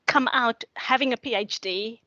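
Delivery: ordinary talk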